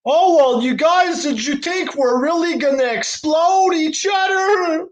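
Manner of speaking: mock him in a high whine; mocking Tony Khan. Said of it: "So Tony Khan is like".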